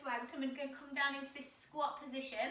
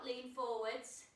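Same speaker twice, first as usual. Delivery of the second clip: ordinary delivery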